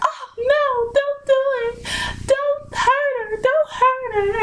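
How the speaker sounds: very high, drawn-out mock wail; imitated crying mom. Mother begging police not to hurt her daughter, imitated crying. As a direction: plead in a high imitation cry. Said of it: "my mom was just crying".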